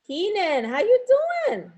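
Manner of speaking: cheerful, rising hello to a student; bright greeting. Real, not acted. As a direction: voice leaps high on how you.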